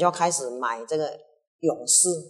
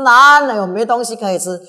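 哪 punched loud and high; indignant retort; 6 semitones higher, 14 dB louder than usual. Acted. Retainer answering the king's mockery, indignant loud retort. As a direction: snap back, hitting 哪 hard.

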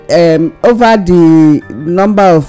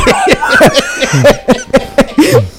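sudden high laugh bursting out; burst of laughter. Real, not acted. Mocking the borrowing.

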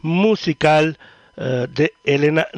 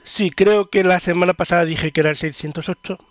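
normal talking voice

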